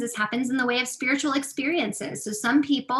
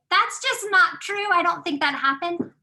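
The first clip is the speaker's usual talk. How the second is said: sceptical objection; 7 semitones higher, 5 dB louder than usual. Pretend. A sceptic in the audience objecting, higher and louder. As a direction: push back like a doubter.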